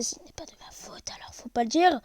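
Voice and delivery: hushed confiding whisper; conspiratorial whisper. Pretend. Gamer whispering to viewers about the bugs. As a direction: let them in on a secret.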